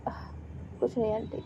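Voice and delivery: soft, sinking sigh of a reply; sad. A narrator's reading. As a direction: brush it off softly, sadly. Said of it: "माया थोड़ी sad लग रही थी".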